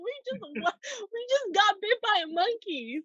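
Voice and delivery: high, fast, laughing what you mean; incredulous laughing disbelief. Retold or imitated. Incredulous at other girl.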